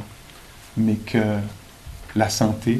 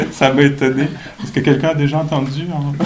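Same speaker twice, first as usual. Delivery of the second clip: higher, louder, still cracking up; laughing; 4 semitones higher, 5 dB louder than usual. Real, not acted. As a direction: ask it through leftover laughter. Still laughing after joke. (en riant encore)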